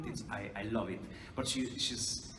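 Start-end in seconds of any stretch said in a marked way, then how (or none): none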